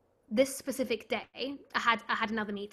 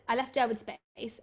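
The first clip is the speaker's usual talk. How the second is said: normal talking voice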